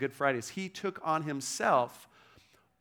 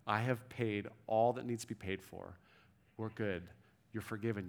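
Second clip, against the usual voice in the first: softer, gentle "we're good, you're forgiven"; tender assurance; 5 semitones lower, 8 dB quieter than usual. Retold or imitated. Savior assuring a failing follower, soft and tender. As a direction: settle it quietly, like a promise.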